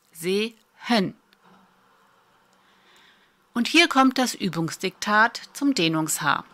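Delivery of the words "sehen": "Sehen" as two separate syllables, "Se - hen"; audible syllable-separating H. Shown as a demonstration. Teacher proving the H is audible, "Sehen" split before a sounded H. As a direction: pause in "Sehen", then sound the H clearly. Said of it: "Und du kannst es hören".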